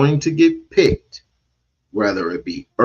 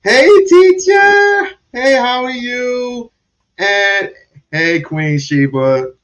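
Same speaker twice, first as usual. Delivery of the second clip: high, delighted hey to a viewer; thrilled to see a donation pop up; excited greeting; 13 semitones higher, 4 dB louder, slower than usual. Real, not acted. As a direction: sing out a surprised hello, slowed and stretched.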